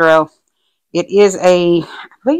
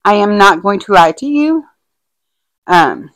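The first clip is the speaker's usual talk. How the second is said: normal talking voice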